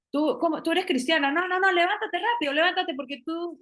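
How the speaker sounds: hammed-up church friend telling you not to cry; mocking pushy believer. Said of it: "Entonces no podemos decir".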